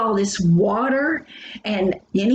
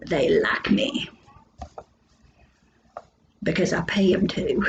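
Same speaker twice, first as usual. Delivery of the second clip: whispered punchline, dropped low; sly whispered aside to the class; joking whisper aside; 3 semitones lower than usual. Pretend. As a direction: confide the joke under your breath. Joke aside about delivery men.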